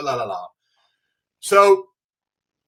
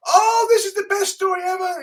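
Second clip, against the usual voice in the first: much higher and louder, breathless gush; gushing fan; 5 dB louder than usual. Retold or imitated. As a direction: play an overwhelmed fan at a signing. Fan gushing at Neil's table.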